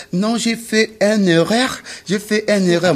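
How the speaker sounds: high, shrill-free falsetto call to the waiter; mock woman ordering. Comedian mimics a woman calling the waiter.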